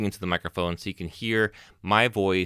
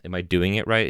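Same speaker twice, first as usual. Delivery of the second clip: normal talking voice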